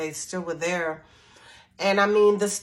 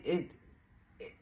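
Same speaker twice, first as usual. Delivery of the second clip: usual speaking voice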